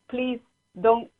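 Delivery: usual speaking voice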